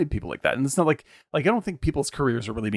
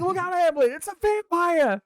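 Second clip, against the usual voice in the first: mock shout. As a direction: yell it at the stage like a frantic audience member. Lincoln shouting at the play.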